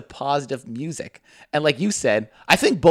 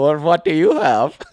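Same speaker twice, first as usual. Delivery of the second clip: drawn-out, hammed-up coworker impression; slow mock-accented question; hacky Hassan; 4 dB louder than usual. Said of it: "kind of a hacky Hassan".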